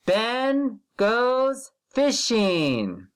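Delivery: slow, drawn-out word-by-word reading; slow model reading. Shown as a demonstration. Teacher slowly modelling a sentence for first graders. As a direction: read it slowly so the children can follow. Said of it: "Follow [name]".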